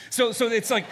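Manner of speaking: breaking into a laugh. Real, not acted. Preacher laughing at his CrossFit joke, real laugh. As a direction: laugh at your own joke. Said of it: "Oh, don't worry, they'll tell you".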